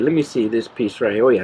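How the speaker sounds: normal talking voice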